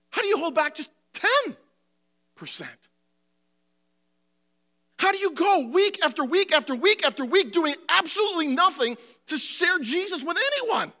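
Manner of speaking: fervent pulpit challenge; impassioned preaching. Acted. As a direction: press the question home to the congregation. Preaching at full force.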